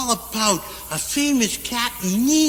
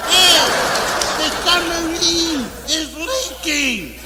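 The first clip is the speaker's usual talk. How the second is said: high comic holler, stretched out; drawn-out, raised-pitch shout of alarm; hollering over intercom; 5 semitones higher, slower than usual. Acted. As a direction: call the alarm through the ship. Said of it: "Captain Nemo hollers through the intercom".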